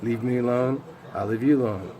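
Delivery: ordinary talk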